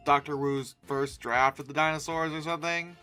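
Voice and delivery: normal talking voice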